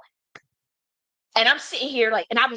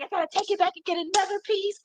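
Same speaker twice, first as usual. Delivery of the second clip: high, fretful, near-tears whine; high whiny self-quote; 11 semitones higher than usual. Retold or imitated. Retelling her panicked plan to return the piece, high whine. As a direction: squeak it out like you're about to give up. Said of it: "'Cause I'm like".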